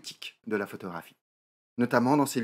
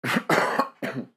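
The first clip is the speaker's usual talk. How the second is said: dry cough from a lingering flu. Real, not acted. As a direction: cough once, harshly. Just recovering from flu. (toux sèche)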